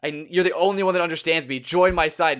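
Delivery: ordinary delivery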